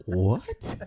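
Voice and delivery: usual speaking voice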